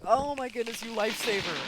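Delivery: high, loud thrilled gasp of relief; squealing with relief; overjoyed gush. Retold or imitated. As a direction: gush at him like he saved your life. Overjoyed quote to repair guy.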